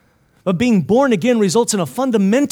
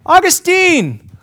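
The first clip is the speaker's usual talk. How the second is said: high, loud, drawn-out call; acted woman hailing a man; woman calling after him; 11 semitones higher, 7 dB louder, slower than usual. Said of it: "this woman who's a prostitute is following him going".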